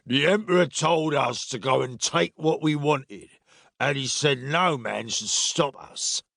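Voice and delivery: lightly voiced drunken soldier. A narrator's reading. Soldier justifying the looting to Silas, lightly drunken voice. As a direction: argue it as a soldier in drink.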